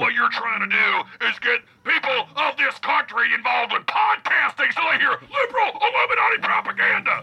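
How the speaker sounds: high ranting conspiracy voice; Alex Jones impression. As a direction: build into a paranoid yell. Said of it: "It's Alex Jones".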